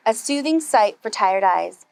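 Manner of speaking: usual speaking voice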